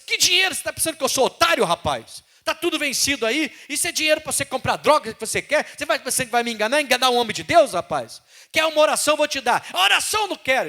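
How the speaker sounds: high, loud, fast furious rebuke; angry scolding. Retold or imitated. Stern pastor scolding the man with the expired prescription, angry shouting. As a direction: tear into him, outraged. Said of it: "eu era meio bravo naquela época".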